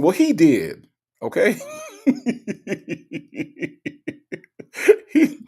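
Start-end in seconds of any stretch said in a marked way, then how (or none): 1.6-4.3 long high wheezing laugh; helpless high-pitched laughing fit; real laughter. Real, not acted. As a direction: crack up in a long high giggle. Cracking up at the story.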